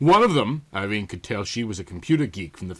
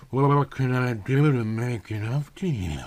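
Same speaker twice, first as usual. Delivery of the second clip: husky drawl. Acted. Computer voice asking Bruce, a slight husky drawl. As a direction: drawl it like tequila-soaked silk. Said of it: "husky drawl".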